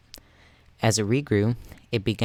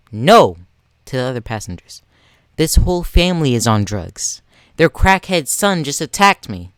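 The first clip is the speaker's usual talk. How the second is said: loud accusing cry; 3 semitones higher, 7 dB louder than usual. Acted. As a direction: shout out accusations to everyone listening. Entitled mother yells at passengers.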